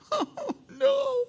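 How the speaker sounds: tiny falsetto wail of dismay; mock freak-out. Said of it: "I could see her freaking out".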